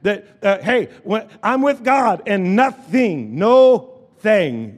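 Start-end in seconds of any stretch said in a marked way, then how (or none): 3.4-4.8 "no thing" as two separate stressed words; split into two words. Shown as a demonstration. Preacher breaking nothing into two words, emphatic "no thing". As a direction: stretch "no", then land firmly on "thing". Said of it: "two words put together make one word".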